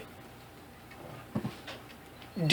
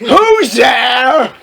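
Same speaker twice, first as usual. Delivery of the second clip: shouted angrily; 2 semitones higher, 4 dB louder than usual. Acted. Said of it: "he shouted angrily".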